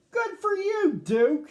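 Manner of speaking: put-on high, loud and delighted child's voice; happy cry. A narrator's reading. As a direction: cheer the dog on with high joy. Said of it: "Sammy cried".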